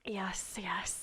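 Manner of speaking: soft whispered aside; hushed, joking aside. Real, not acted. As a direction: drop to a breathy whisper.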